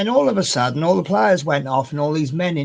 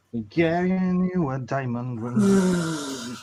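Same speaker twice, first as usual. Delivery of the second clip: slow, drawn-out mock proclamation; mock grand announcement; 4 dB quieter, much slower than usual. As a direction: stretch it out grandly, teasing. Mock-grand variant of quote.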